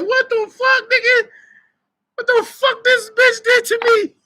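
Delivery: little brother staring in disbelief, voice cracking high; shocked brother. Retold or imitated. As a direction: squeak it out like a stunned little brother. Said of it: "he was shocked".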